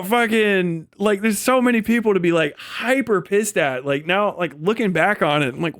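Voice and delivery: ordinary delivery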